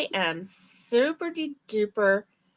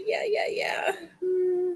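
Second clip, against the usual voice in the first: whisper; 9 semitones higher, 4 dB quieter than usual. Real, not acted. Whispered high aside.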